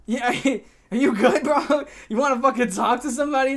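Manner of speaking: high, loud, halting check-in; retold incredulous worry with a laugh; mock concern. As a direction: ask it in amused alarm, pitch up. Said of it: "I'm like".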